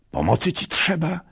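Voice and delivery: high, loud eager offer; urgent call. Acted. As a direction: press him urgently to accept help. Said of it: "zawołał".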